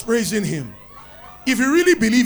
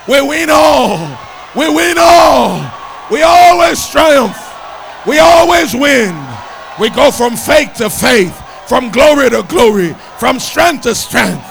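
loud, rousing victory declaration; full-force preaching; 3 semitones higher, 8 dB louder than usual. Real, not acted. Preacher declaring to congregation they always win, rousing full-force shouting. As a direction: drive it home in a preacher's shout.

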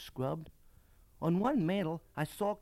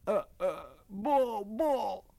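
halting, higher-pitched blurts; tongue-tied mutter; 6 semitones higher, 4 dB louder, slower than usual. A narrator's reading. Boy unable to form a sentence for the lady, tongue-tied. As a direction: stammer out single words, helpless. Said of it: "I managed to mutter".

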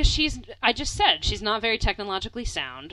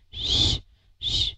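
shushing. Retold or imitated. Mom shushing her.

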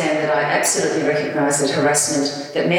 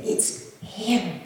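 hushed; 4 semitones higher, 7 dB quieter than usual. Retold or imitated. Friend signals silence on phone.

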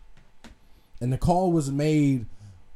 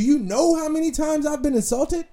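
indignant; 13 semitones higher, 4 dB louder than usual. Real, not acted. Indignant rhetorical question.

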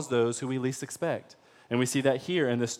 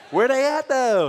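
high, loud streetwise challenge; slangy, pitched-up demand; 9 semitones higher, 6 dB louder than usual. Acted.

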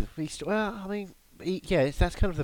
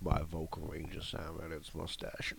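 Sam Elliott voice; 6 semitones lower, 6 dB quieter than usual. Retold or imitated. Joking about his vocal range, light Sam Elliott impression.

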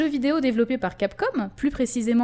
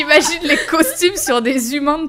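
laughing; 3 semitones higher, 6 dB louder than usual. Real, not acted. Laughing at cursed idea.

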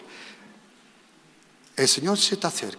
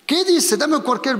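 loud and much higher, pouncing question; sudden call-out; 9 semitones higher, 5 dB louder than usual. Real, not acted. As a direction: call out sharply to grab attention. Calls out to volunteer suddenly.

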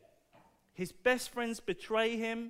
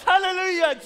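loud, high Hallelujah cry; rousing hallelujah; 9 semitones higher, 10 dB louder than usual. Real, not acted. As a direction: lift the room with a shouted Hallelujah. Preacher rousing congregation.

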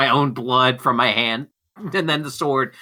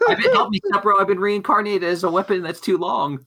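high squeaky helpless whine; high mock plea; 7 semitones higher than usual. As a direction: squeal it like a helpless anime girl.

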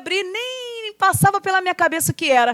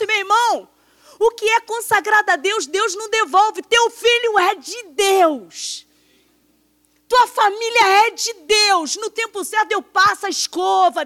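higher, fervent preaching, slowing on key lines; 6 semitones higher than usual. Acted. Preacher exhorting congregation about God's faithfulness, fervent preaching. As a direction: preach it with rising fervor.

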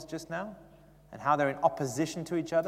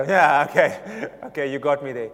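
joking concession; 11 dB louder than usual. Retold or imitated. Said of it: "normally with my humor, I'd go".

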